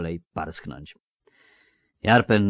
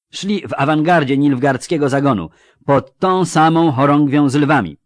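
loud, raised, animated dwarf veteran; higher, punchy character voice; forceful dwarf officer; 7 semitones higher, 9 dB louder than usual. Acted. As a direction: recount it hotly to comrades.

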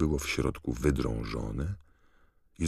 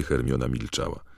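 ordinary delivery

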